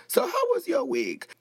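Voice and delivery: high-pitched sassy host greeting; Wendy Williams. Acted. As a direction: swoop up high on the question, then drop. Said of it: "say it a lot like Wendy Williams".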